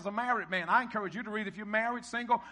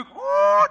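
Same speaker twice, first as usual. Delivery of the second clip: high, loud "ooh"; sudden falsetto holler; preacher's whoop; 11 dB louder than usual. Real, not acted. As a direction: whoop with delight mid-sentence. Preacher whoops mid-verse.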